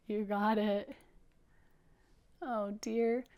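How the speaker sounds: ordinary delivery, nothing marked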